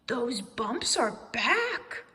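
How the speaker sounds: slow, loud alarmed cry; shouting. A narrator's reading. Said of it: "shouted Owl".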